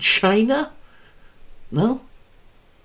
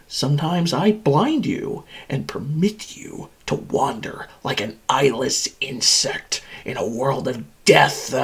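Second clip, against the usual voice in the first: ordinary talk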